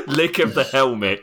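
ordinary delivery

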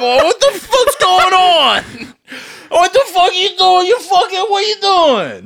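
loud, high, slowed hammed-up outrage; mock outraged yell. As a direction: yell like a man caught off guard. Acting out being jerked off.

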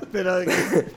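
suppressed laughter; trying not to laugh; stifled giggles. Real, not acted. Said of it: "No te rías, huevón".

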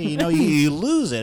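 ordinary talk, nothing marked

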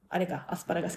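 ordinary delivery

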